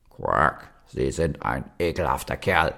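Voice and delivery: low croaking frog voice, cross; angry frog. A narrator's reading. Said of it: "Da wurde der Frosch böse".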